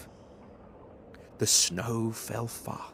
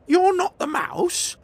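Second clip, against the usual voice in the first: put-on very high, loud questioning child voice; a kid baffled again; surprised child; 10 dB louder than usual. Gruffalo's child asking the owl, surprised.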